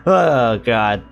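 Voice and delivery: ordinary talk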